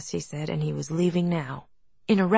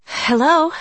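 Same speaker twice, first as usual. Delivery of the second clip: breathless; 9 semitones higher, 10 dB louder than usual. A narrator's reading. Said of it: "she said breathlessly".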